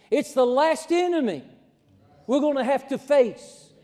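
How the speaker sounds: high, loud, rising preacher's build; preaching at full force. Acted. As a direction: climb to a ringing climax.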